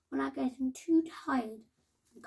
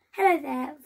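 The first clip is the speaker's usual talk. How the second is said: louder, higher friendly call; 4 semitones higher, 9 dB louder than usual. A narrator's reading. Fox greeting Salmon across the water, calling out.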